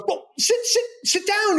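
high-pitched, stammered invitation; flustered. Retold or imitated. Co-star offering newcomer a seat, flustered. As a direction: imitate him fumbling to offer a seat. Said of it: "like, flustered".

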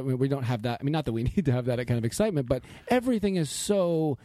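ordinary talk

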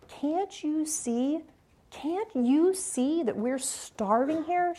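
high, slow, pained pleading; desperate plea. Retold or imitated. Mother in Niger begging the visitor for help, high, slow desperate plea.

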